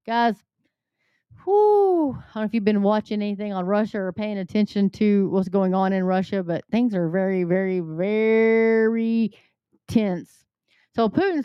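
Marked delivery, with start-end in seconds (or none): 1.4-2.1 loud, high exhale of dread; heavy whew. Real, not acted. Exhale before grim Russia news. 7.4-9.3 very, very stretched out slowly and heavily; drawn-out very. Real, not acted. Stretching words to stress tension.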